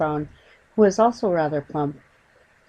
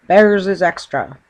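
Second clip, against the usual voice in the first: gruff cabbie; 8 dB louder than usual. Acted. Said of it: "he said gruffly".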